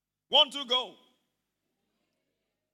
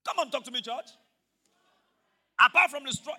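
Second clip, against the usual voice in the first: ordinary talk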